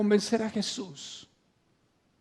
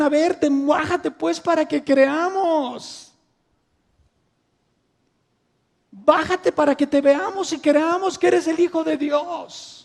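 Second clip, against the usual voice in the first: higher and louder mocking jeer; taunting shout; 6 semitones higher, 5 dB louder than usual. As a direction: taunt him from below the cross. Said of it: "Los fariseos le gritaban".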